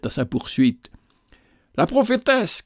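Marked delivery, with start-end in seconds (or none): 1.8-2.6 crying out. A narrator's reading. Soldier as the captive flees, high cry. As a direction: call out the alarm, pitch well up. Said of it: "criait-il".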